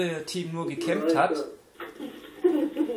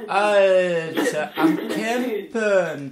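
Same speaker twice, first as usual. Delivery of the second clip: laughing; 2 semitones higher, 5 dB louder, much slower than usual. Real, not acted. Laughing at a camper in game.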